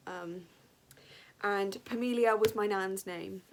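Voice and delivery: usual speaking voice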